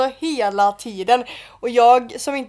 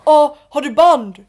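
louder, drawn-out challenge; mock confrontational demand; 4 semitones higher, 12 dB louder, slower than usual. Retelling how a guy demanded wristbands, mock confrontational. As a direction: imitate a pushy guy calling someone out.

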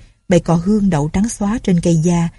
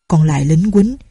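ordinary delivery

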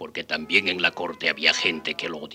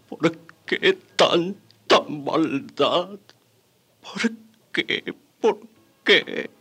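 broken, sobbing recollection; grief-stricken; 3 semitones higher, 3 dB louder than usual. Acted. Survivor recalls the massacre, breaking down in grief. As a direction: force the words out through tears.

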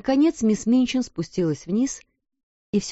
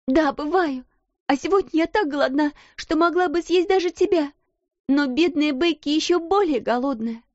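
passionate; 9 semitones higher than usual. A narrator's reading. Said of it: "страстно заговорила".

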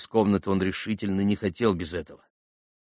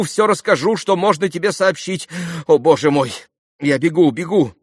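high, loud, harried pleading; flustered excitable mother; 9 semitones higher, 6 dB louder than usual. A narrator's reading. Khokhlakova hushes Lise before running off, high and flustered. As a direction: fend off the daughter in a fluster.

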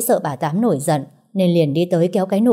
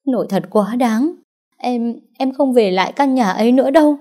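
sobbing; 6 semitones higher than usual. Acted. Nụ crying to Tuấn under the banyan tree, sobbing. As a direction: stutter it out between sobs. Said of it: "Cô nấc lên từng tiếng".

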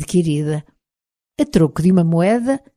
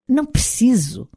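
impatient reply, pitched up; 7 semitones higher than usual. A narrator's reading. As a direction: brush it off impatiently, higher. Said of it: "repliquei impaciente".

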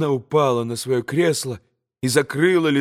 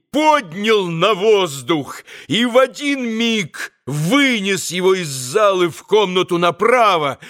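usual speaking voice

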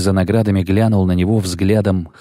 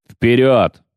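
«Вперёд!» louder, a firm command; rallying shout; 5 semitones higher, 3 dB louder than usual. A narrator's reading. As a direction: echo the order loudly to the men. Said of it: "повторил Филин". (громкий приказ «Вперёд!»)

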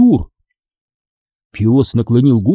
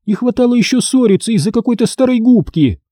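high, exasperated mother's voice; irritated reprimand; impatient scolding; 11 semitones higher than usual. A narrator's reading. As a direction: scold the bickering children. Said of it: "нетерпеливо прикрикнула мама".

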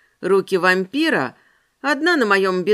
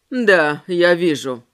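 man's voice; 6 semitones lower, 4 dB louder, slower than usual. Acted. Ramirez voiced in dialogue.

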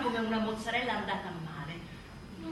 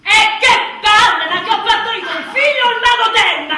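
loud, higher, laughing blow-up; exasperated outburst; 8 semitones higher, 18 dB louder than usual. Acted. Fed-up mother blowing up at the advice, loud and laughing. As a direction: explode in comic frustration.

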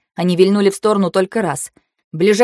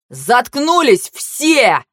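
slow, drawn-out raised roar; barked order; 4 semitones higher, much slower than usual. A narrator's reading. Kantor silencing the screaming passengers, barked order. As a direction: roar for silence, stretching each word. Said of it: "рявкнул Кантор".

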